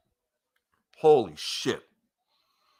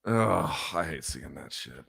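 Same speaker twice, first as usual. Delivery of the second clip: much lower and softer, heavy and downcast; choked up; 10 semitones lower, 8 dB quieter than usual. Real, not acted. Streamer watching a dejected fighter, quietly choked up. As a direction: hold back tears for the beaten fighter. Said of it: "I'm about to get a little choked up".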